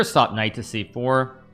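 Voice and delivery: normal talking voice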